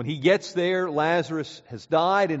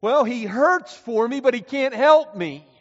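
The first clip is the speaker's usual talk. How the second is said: higher and much louder, ringing out; full-force preaching; 10 semitones higher, 9 dB louder than usual. Acted. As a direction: build the sermon to its climax. Climactic preaching.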